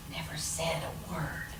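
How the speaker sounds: quiet refrain ending the tale; hushed refrain. A narrator's reading. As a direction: let it fade to a hush.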